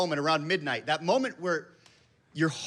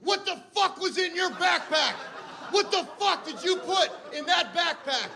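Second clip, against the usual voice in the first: high, laughing mock shriek of an angry wife; imitated screaming wife; 14 semitones higher than usual. Wife screaming down the phone, high mock shriek. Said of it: "just screaming".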